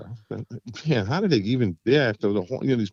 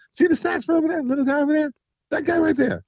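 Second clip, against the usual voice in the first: much higher, excited insisting; eager pointing out. Retold or imitated. Pointing out the sax player to doubting friends, high and eager. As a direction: show them, he's right there.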